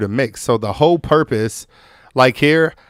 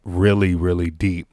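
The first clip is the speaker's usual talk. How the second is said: deep morning voice; 5 semitones lower than usual. Shown as a demonstration. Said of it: "my voice is like".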